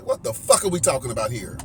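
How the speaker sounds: ordinary delivery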